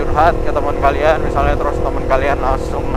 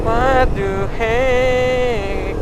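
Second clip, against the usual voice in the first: drawn-out what the heck; 10 semitones higher, much slower than usual. Real, not acted. Rain suddenly heavy.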